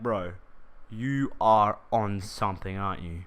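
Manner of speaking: low, slow teasing voice; lightly voiced skeptical friend; deadpan mate. Mate teases him in disbelief.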